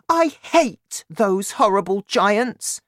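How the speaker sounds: put-on high, loud, slow girlish outrage; indignant cry. A narrator's reading. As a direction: stress the hatred in a high child's voice. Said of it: "Sophie cried".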